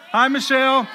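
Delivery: loud, high introduction to a crowd; leading a greeting. Real, not acted. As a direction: get the whole room to greet her. Leads crowd in greeting wife.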